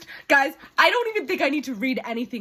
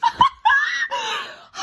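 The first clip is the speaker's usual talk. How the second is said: very high, loud squealed laugh; shrieking laugh; 5 dB louder than usual. Real, not acted. Reader delighted by romantic line, shrieking laugh.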